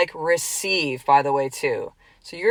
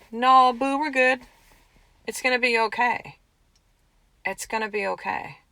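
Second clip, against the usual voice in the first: reassuring; 5 semitones higher than usual. Retold or imitated. Body voiced reassuring fear.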